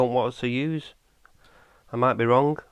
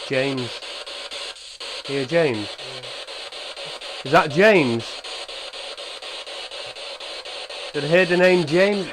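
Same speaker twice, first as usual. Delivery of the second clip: calling out to a spirit; 3 semitones higher, 5 dB louder, slower than usual. Real, not acted. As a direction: call out the name into the dark, straining to hear. Hears a name during the session.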